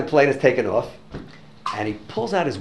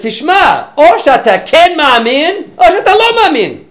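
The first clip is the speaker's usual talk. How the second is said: high, loud, slow declaration; emphatic loud either-or; forceful ultimatum; 15 dB louder, slower than usual. Retold or imitated. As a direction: lay down the choice with full force. Speaker delivers forceful ultimatum.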